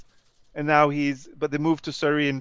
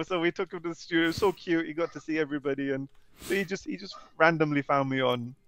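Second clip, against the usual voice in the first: ordinary delivery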